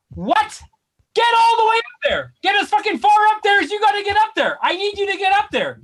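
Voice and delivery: high, stretched-out incredulous yell; mock outrage, pitch shooting right up; indignant outburst. Patient protesting the doctor didn't get all the way up, indignant.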